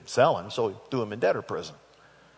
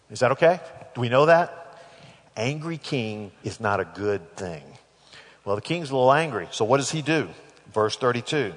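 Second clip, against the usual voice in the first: ordinary delivery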